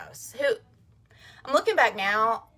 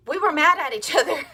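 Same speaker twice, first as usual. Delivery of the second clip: laughing; 8 semitones higher, 8 dB louder than usual. Real, not acted. Laughs while telling the story.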